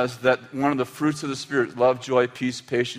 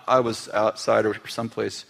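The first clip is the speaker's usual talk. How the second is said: ordinary delivery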